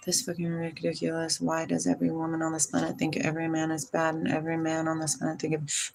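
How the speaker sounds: low, soft mumble; hushed monotone reading; quiet read-out. Real, not acted. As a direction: read it under your breath, running on quickly. Reads out a viewer's complaint.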